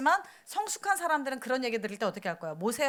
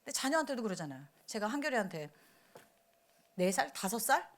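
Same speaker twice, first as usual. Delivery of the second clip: ordinary talk, nothing marked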